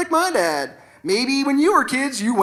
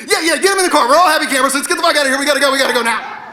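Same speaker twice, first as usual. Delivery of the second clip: raised-pitch rush, speeding up at the end; hurried dad; 3 semitones higher, 4 dB louder, much faster than usual. Acted. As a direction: hustle everyone out as a panicky father. Father hurries kids into car.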